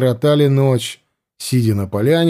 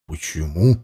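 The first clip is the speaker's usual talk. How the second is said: surprised; 10 semitones lower, 4 dB quieter than usual. Acted. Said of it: "удивлённо спросил Варлыга".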